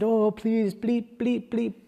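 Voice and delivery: high, pleading little sheep voice; frightened sheep-lion. Acted.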